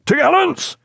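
captain ordering topgallants set; shouted order. A narrator's reading. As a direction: fling the order up to the tops.

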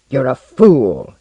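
loud, slow, angry insult. A narrator's reading. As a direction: throw the insult with heat. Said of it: "hot with anger".

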